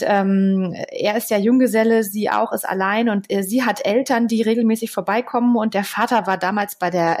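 ordinary talk, nothing marked

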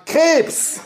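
very high, eager cry; shouted answer. A narrator's reading. Grateful for mother's tip, boy calls the answer, shouting. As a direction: call it out high, sure of it. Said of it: "rief ich zum zweiten Mal".

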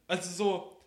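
soft, turned away from the mic. Real, not acted. Storyteller showing how he tucked the note away, off the mic. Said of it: "Du musst ins Mikro sprechen".

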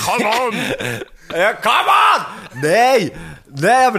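high, loud hammed-up protest; drawn-out exasperated yell; mock exasperated shout. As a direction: cry out in playful outrage. Exaggerated protest to teasing friends.

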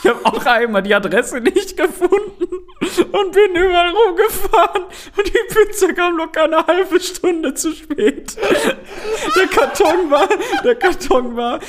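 high, cracking laughter through words; telling it while laughing helplessly; laughing while talking. Real, not acted. Laughing while telling embarrassing story.

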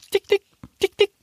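very high bird twitter; robin twitter. Acted. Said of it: "zwitschert das Rotkehlchen".

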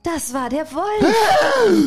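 spring the scary twist; slow laughing dramatic reveal. A narrator's reading.

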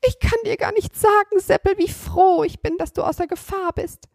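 put-on lightly sobbed joy of an old woman; sobbing grandmother. Grandmother tells Seppel how glad she is, tearful. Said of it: "als sie schluchzte".

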